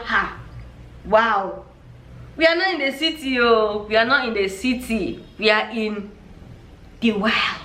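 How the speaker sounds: ordinary talk, nothing marked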